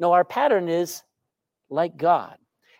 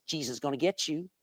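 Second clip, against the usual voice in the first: softer, lower, spooky voice from the dark; eerie parrot voice; 6 semitones lower, 11 dB quieter, faster than usual. Acted. A parrot in the dark spooks the burglar, lower and hushed. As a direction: hush it like an unseen warning.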